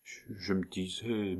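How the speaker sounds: hushed, hesitant start; murmur. A narrator's reading. Count hesitantly confessing his doubts to the doctor, murmuring. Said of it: "murmura Joulou".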